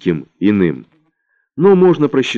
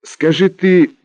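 high, slow pleading cry; exclaiming; 6 semitones higher, slower than usual. Acted. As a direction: exclaim it, begging him to act. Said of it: "воскликнула Светлана".

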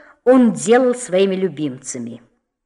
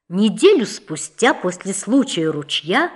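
plain reading voice

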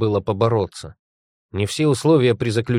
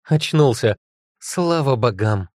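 relieved; 3 semitones higher, 3 dB louder, slower than usual. Acted. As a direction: burst out in relief. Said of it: "Облегчённо выдохнула тётушка".

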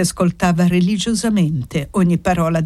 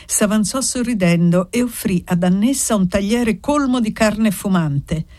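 ordinary delivery, nothing marked

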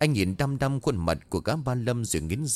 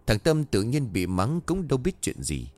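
plain reading voice

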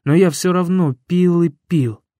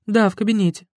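higher woman's voice; Yulia's voice; 6 semitones higher, faster than usual. Acted. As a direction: answer higher, light.

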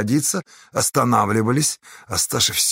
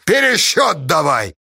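slow, higher insistent call; demanding; 5 semitones higher, 4 dB louder than usual. A narrator's reading. Pozdey demanding a recount, insistent. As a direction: demand it firmly and loudly. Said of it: "потребовал Поздей".

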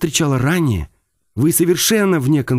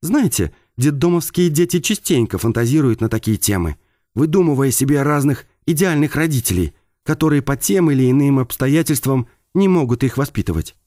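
higher, hushed voice with held-back anger; restrained anger; 5 semitones higher than usual. Acted. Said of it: "вибрировал сдерживаемый гнев".

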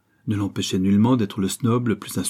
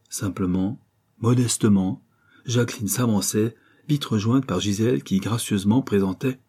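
ordinary delivery

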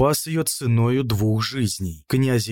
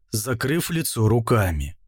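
plain reading voice

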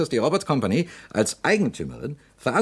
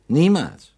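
"Niemals" hammered out higher and louder, falling hard; emphatic never; 6 semitones higher, 7 dB louder than usual. Acted. Investigator insisting to the doctor, "Niemals" punched loud and high. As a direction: slam down "Niemals" as a loud final word. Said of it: "Ich sage ausdrücklich".

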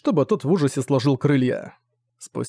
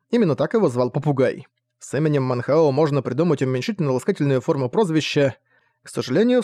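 ordinary delivery, nothing marked

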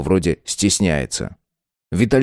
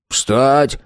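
barked command; 6 semitones higher than usual. A narrator's reading. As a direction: snap it out like an army order. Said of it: "скомандовал Валерик".

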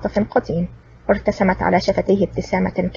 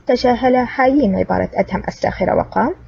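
ordinary delivery, nothing marked

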